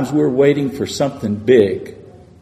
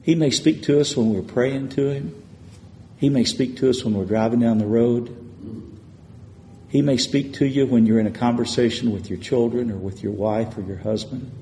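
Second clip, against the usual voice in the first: ordinary talk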